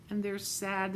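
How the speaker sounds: usual speaking voice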